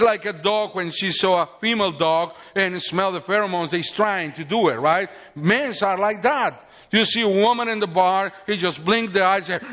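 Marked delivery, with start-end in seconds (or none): none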